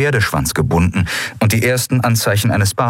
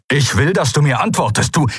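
raised-pitch, insistent outburst; angry demand; 7 semitones higher than usual. A narrator's reading. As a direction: demand an answer, building toward an insult. Father demands answer, is cut off. (wütend fordernd)

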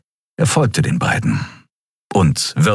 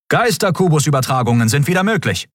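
higher-pitched status report; announcing communications officer; 9 semitones higher, faster than usual. A narrator's reading. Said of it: "verkündete der Kommunikationsoffizier". (hohe Stimme, meldender Funkoffizier)

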